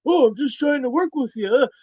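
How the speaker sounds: high, loud, whiny put-on voice; mock innocent excuse. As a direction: play the guilty guy's fake innocence. Quoting the other wrestler's excuse.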